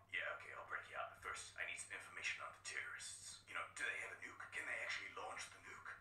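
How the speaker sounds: low, whispered covert soldier. Acted. Snake to the captive, low and whispered. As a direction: keep it hushed like a spy.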